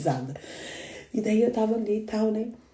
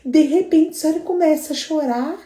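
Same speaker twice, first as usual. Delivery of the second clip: daughter laughing at mom; 7 semitones higher, 5 dB louder than usual. Retold or imitated.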